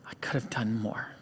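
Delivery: imitating a whispered confession; very soft, low, barely voiced retelling; hushed confiding. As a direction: say it under your breath, as if leaning in to a friend. Leans into friend, confiding remorse.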